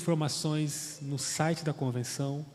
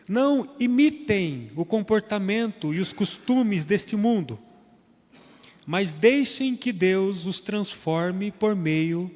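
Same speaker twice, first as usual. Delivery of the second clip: raised, louder reading of the Bible text; solemn scripture reading; 3 semitones higher, 5 dB louder than usual. Acted. Preacher reading Romans twelve to the congregation, solemn and raised. Reads verse two aloud.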